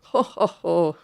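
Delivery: hearty ho ho ho from the wise elders; amused desert fathers chuckling at her excuse; jolly laugh. Pretend. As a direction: laugh it off knowingly. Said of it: "the answer to that was".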